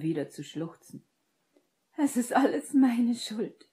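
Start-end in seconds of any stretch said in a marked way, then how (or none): 2.0-3.7 high, loud, breathy self-blame; tearful blurting, pitched high; anguished outburst. A narrator's reading. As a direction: force it out through sobs. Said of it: "stieß sie hervor".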